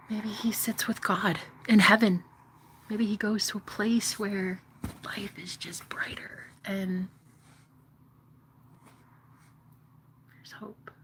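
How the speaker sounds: soft hopeful whisper. Real, not acted. Whispering to viewers about him in heaven. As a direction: let hope fade into a whisper.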